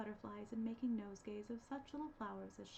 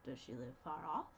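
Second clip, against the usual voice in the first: lower, sly wolf question; 8 semitones lower than usual. A narrator's reading. Wolf questioning the girl, in a low voice. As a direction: ask like a wolf sizing her up. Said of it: "said the wolf".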